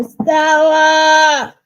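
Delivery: loud, high, sung-out mock Brando bellow; hammed-up Streetcar Named Desire cry; Stella yell. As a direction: bellow it up to a balcony. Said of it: "Streetcar named Desire".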